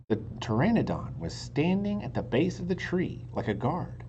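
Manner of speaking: usual speaking voice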